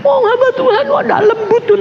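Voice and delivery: high-pitched imitation of crying grievance; mock tearful complaint. Counselee in tears complains about the pastor, mock tearful. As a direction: whine it high, as a weeping visitor. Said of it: "dia akhirnya nangis".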